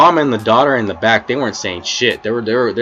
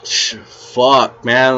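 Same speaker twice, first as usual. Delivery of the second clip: louder, cut-off curse; exasperated; 3 semitones higher, 4 dB louder, much slower than usual. Retold or imitated. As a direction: throw up hands with a loud fuck man. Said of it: "I'm like".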